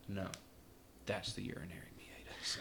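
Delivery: firm teacher's whisper. Retold or imitated. Nursing teacher quietly correcting her student at the bedside, whispered. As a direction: correct him under your breath.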